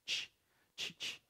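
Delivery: isolated soft "ch", repeated; ch sound. Shown as a demonstration. Teacher isolating the sound, soft separate ch. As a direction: say Ch alone, voiceless and short. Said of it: "So the T becomes ch".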